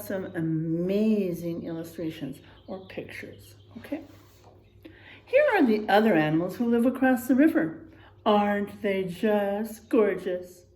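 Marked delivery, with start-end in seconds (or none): none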